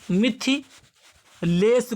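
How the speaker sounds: Mithilesh stretched very slowly, Kumari after; slow emphatic Mithilesh; drawn out. Shown as a demonstration. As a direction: linger on each sound of Mithilesh. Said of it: "क्या होता है?".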